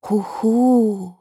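high, loud "hoo-hoo"; drawn-out eerie hoot; calling hoot. A narrator's reading. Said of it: "hörten sie alle ein Rufen". (hohes, lautes Huhu-Rufen)

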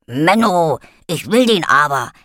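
loud, whiny puppy protest; barking dog voice. A narrator's reading. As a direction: bark it out like a sulky dog. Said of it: "bellte Bonsai".

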